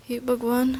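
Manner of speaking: ordinary delivery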